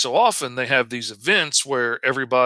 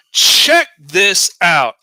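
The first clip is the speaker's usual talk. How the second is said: loud, high, drawn-out hype call; excited call-out; 6 semitones higher, 9 dB louder, much slower than usual. Real, not acted. Host grabbing viewers' attention, excited call-out. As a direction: punch it out big and slow.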